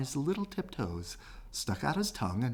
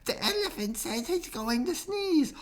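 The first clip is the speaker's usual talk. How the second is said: fretful bees. Acted. Bees warn the bear.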